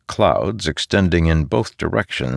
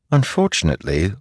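plain reading voice

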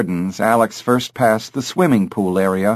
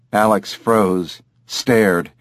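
ordinary delivery, nothing marked

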